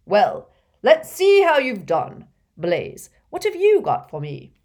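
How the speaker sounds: a hint of a booming teacher; lightly voiced jolly professor. A narrator's reading. Slughorn voiced by narrator.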